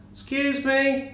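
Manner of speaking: high, slow timid rabbit asking politely; talking rabbit. Acted. Joke, rabbit speaks to barman.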